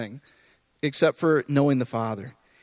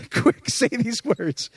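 mock urgency; 6 semitones higher, much slower than usual. Mocking deathbed evangelism.

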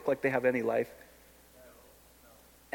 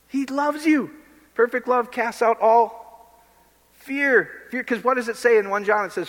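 ordinary talk

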